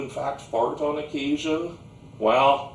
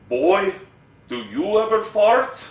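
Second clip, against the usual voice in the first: put-on Slavic-accented grandpa, louder and rising to a high question; thick Slavic accent; 8 semitones higher, 7 dB louder than usual. Grandpa questions the boy kneeling in the dirt, thick Slavic accent. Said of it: "in his thick Slavic accent".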